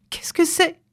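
put-on high-pitched startled old woman; jolted, high little shriek of a question; startled cry. A narrator's reading. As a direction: cry out in sudden alarm. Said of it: "s'écria la vieille en tressaillant".

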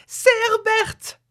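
very high, drawn-out cry; frantic, stretched-out shriek. A narrator's reading. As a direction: wail it slowly at the top of the range.

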